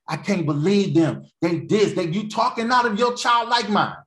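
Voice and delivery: worked-up, mock-angry preacher; acted anger. Preacher acting out the anger stage, indignant. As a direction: build to a high scolding shout.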